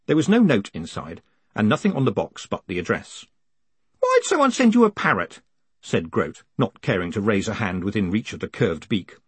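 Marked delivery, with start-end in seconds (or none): 4.0-5.4 high-pitched character voice, baffled question; high, put-on querying voice; puzzled underling. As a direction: ask it high, like a baffled old subordinate. Groat's acted voice, puzzled question.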